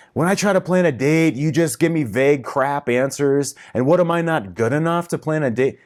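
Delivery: hammed-up outburst of a hurt boyfriend; mock angry rant. Voicing client's angry inner rant.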